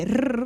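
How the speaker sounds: "R" as a long tongue-tip trill; rolling R. Shown as a demonstration. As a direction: trill the "R" and hold it. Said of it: "which is the rolling R".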